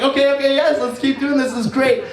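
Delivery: mock eager. Eager agreement quoted.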